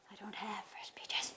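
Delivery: soft confiding whisper; whispered aside. Real, not acted. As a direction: whisper it like a little secret. Confiding aside about the peaches.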